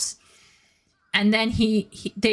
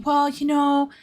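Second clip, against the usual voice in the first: a light high nervous character voice; timid anime boy; 6 semitones higher than usual. Retold or imitated. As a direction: offer it shyly, hoping it's okay. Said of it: "he starts saying".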